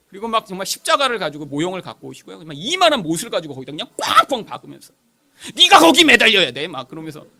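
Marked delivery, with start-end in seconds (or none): 5.5-6.7 loud, high menacing order; threatening shout. Retold or imitated. Evangelist hammering nails orders a child onto the cross, threatening shout.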